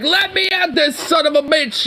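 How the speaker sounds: put-on high, worked-up drunk bellow; hammed-up barroom brawler; belligerent drunk New Yorker. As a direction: play a drunk itching for a fight. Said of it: "belligerent drunk New Yorker".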